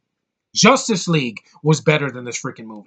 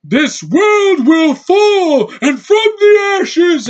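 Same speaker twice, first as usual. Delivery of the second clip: mock villain bellow, much higher and louder, dragged out very slowly; over-the-top mock villain scream, stretched very slow; villain yelling to the heavens; 9 dB louder, much slower than usual. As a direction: ham it up like a cartoon villain roaring at the sky. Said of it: "yelling to the heavens".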